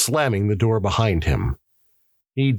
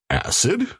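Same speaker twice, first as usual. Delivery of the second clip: higher, rising questioning tone; puzzled echo; 10 semitones higher than usual. Acted.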